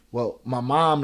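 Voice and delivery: ordinary talk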